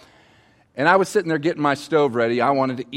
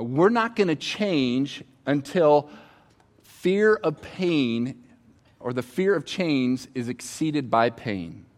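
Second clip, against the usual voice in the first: slow emphatic delivery; slower than usual. Real, not acted. Key point drawn out slowly.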